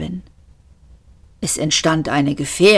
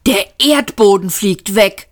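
higher, startled exclamation; shocked, forceful outcry; blurted out; 4 semitones higher, 4 dB louder than usual. A narrator's reading. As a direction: burst out in alarm. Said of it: "stieß er hervor".

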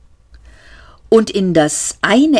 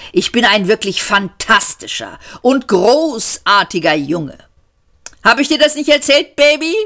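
smug boasting; 4 semitones higher than usual. Acted. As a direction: crow about himself, dragging out the name. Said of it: "zuckte selbstgefällig die Schultern". (selbstgefällig prahlend, höher und lauter)

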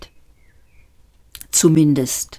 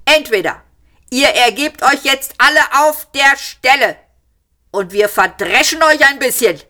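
high, louder shouted speech throughout; shouting policeman; 8 semitones higher, 3 dB louder than usual. A narrator's reading. Policeman shouting his absurd ultimatum, loud. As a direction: shout the whole speech across the room. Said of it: "schrie der Polizist".

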